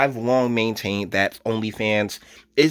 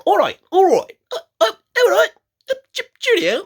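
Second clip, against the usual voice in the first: very high, loud mock British squawk; squeaky-high put-on British warm-up; practising British accent; 6 dB louder, faster than usual.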